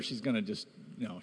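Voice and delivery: ordinary talk, nothing marked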